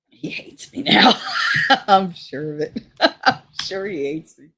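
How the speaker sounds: real laugh breaking through the words. Real, not acted. Admitting her ex hates her now, laughing. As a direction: laugh ruefully while talking.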